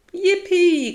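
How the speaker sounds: high, loud whoop; drawn-out, high cheer; joyful cry. A narrator's reading. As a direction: shriek with glee before jumping in. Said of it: "cried Plank".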